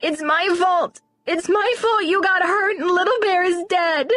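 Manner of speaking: put-on high, crying child's apology; tearful. A tearful little girl apologizing to Joe, crying. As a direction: voice breaking into tears, blaming herself. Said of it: "More tears".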